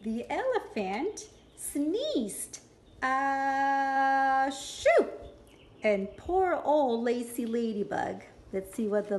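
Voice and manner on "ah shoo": big sneeze. Acted. The elephant sneezed.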